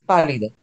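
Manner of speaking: pálida, first syllable hit loud; stress on PÁ. Shown as a demonstration. Teacher to class: stress the first syllable, PÁ-lida. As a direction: lean on the PÁ of pálida. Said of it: "you make the emphasis".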